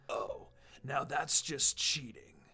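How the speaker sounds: slow, breathy mocking whisper from the mist; a slow unvoiced whisper, mocking and unseen; taunting whisper. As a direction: whisper the taunt to unsettle them. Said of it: "The voice taunted from the mist".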